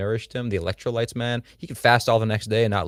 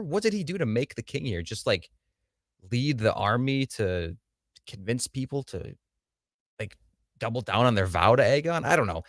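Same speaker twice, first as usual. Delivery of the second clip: ordinary delivery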